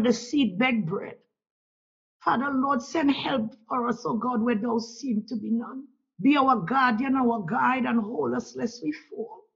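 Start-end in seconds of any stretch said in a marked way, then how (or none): none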